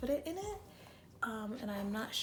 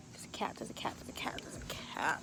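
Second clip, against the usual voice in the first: whisper; 5 semitones lower, 4 dB quieter than usual. Real, not acted. Spots a cat in the yard.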